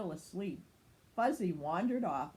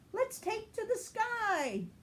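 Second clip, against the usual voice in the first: much higher, slow exclaim; 13 semitones higher than usual. A narrator's reading. Sky lifting off in her helicopter, much higher slow exclaim. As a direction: announce the takeoff. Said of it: "Sky exclaimed".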